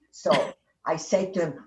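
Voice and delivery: normal talking voice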